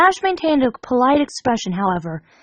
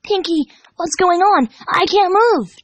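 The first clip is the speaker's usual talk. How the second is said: urgent; 7 semitones higher than usual. A narrator's reading. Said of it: "Dash said urgently".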